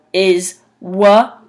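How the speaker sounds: wa-a-k-s stretched letter by letter; drawn-out wa-a-k-s, sound by sound. Shown as a demonstration. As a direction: sound out wa-a-k-s slowly, each sound drawn out. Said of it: "the way it's pronounced is".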